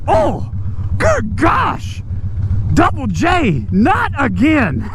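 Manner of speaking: high-pitched retold groan of dismay; mock disgust. Acted exclamations of disgusted passengers.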